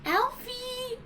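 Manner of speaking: shrill pet-calling shout; calling for Alfie. Retold or imitated. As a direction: call out for the butler like a lost dog. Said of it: "Just going".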